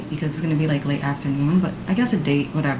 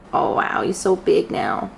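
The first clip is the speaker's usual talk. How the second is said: put-on talking to a baby. Cooing at the dog.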